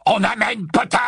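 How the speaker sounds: high, loud triumphant bellow; triumphant roar. Acted. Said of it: "rugit Greyback d'une voix triomphante".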